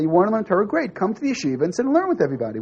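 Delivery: welcoming. Retold or imitated. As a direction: invite him in eagerly, quickening as you go. Welcome the rabbi should have given.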